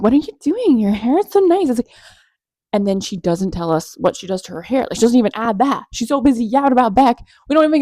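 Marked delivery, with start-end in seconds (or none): 0.0-1.7 high, loud, amazed gushing; mom's amazed praise. Retold or imitated. Mom reacting to Amber's hair, amazed. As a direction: gush over her hair like an amazed mom. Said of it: "even my mom was like".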